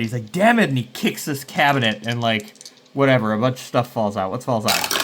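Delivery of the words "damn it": loud, higher angry outburst; frustrated curse. Retold or imitated. Character kicking a cabinet in frustration, loud curse. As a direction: shout it like he just lost everything. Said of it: "he gets all frustrated".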